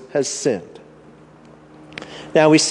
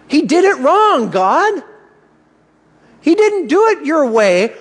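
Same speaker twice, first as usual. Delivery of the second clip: incredulous protest; 10 semitones higher than usual. Retold or imitated. Voicing objection to God.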